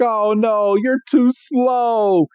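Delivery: high and slow cat-Pokemon complaint; Meowth voice. Acted. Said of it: "And Meowth is like".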